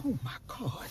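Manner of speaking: a soft, exasperated sigh of a phrase; lightly voiced fed-up social worker.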